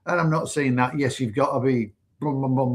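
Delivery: usual speaking voice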